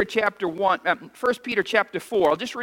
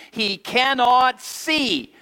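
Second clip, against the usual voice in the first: slow, stress on cannot; congregation repeat; 3 semitones higher, much slower than usual. Shown as a demonstration. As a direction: lean on "cannot" slowly. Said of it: "Say that with me".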